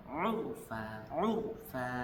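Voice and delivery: "Urfa" begun with a soft throat catch, said gently; ain in urfa. Shown as a demonstration. Teacher showing students how ain starts "urfa". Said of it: "gampang kok".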